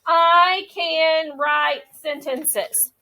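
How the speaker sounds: very slow, stretched words. Shown as a demonstration. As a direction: read as if pointing at each word. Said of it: "Notice how I use my pointer power".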